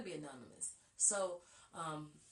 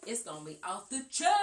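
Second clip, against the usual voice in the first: street voice; 2 semitones higher, 8 dB louder than usual. Pretend. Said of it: "in my street voice".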